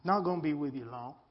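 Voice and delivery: usual speaking voice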